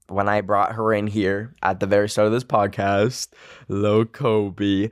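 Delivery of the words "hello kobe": slow, tender hello to a pet; sweet to dog. Real, not acted. Greeting her dog.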